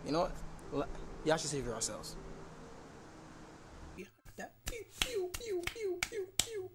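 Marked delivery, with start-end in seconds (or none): 4.7-6.7 high mouth gun sounds; gun noises. Pretend. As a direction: go pew pew like a toy gun. Mimicking gunfire in game.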